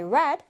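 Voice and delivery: "read" said as "red" within the sentence; past-tense pronunciation. Shown as a demonstration. Teacher modelling a past-tense sentence, "read" pronounced "red". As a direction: give "read" the short e of "red". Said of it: "red랑 발음이 같아져요".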